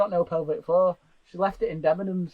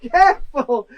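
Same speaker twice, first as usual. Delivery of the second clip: shouting; 4 semitones higher, 9 dB louder, slower than usual. Real, not acted. Shouted mid laughing fit.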